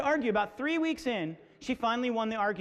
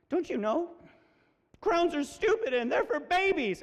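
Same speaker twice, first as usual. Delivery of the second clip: put-on high, upset child voice, building; about to burst into tears; 7 semitones higher than usual. Daughter telling dad about crowns, nearly crying. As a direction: imitate a child on the verge of tears. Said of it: "then just bursts into tears".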